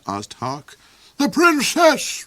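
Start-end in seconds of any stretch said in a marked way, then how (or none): 1.2-2.2 high, loud, slow roar. Acted. The Duke roars a correction at Hark, loud and high. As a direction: bellow it out. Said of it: "roared the Duke".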